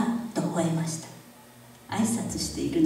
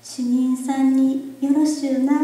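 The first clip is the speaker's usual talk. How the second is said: put-on young girl voice; 5 semitones higher, 5 dB louder, slower than usual. A narrator's reading. Girl voiced, high, slow.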